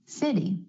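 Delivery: "city" with the T flapped like a quick D. Shown as a demonstration. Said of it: "Instead of city".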